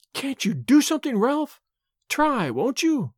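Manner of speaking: high, desperate pleading; anguished plea. A narrator's reading. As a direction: beg him in anguish, slowing at the end. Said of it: "her large eyes full of anguish".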